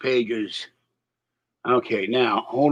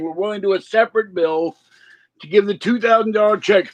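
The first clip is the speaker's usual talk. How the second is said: higher, fast mock politician voice; mock Democrats; 6 semitones higher, faster than usual. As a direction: rattle off like earnest Democrats. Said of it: "The Democrats are saying".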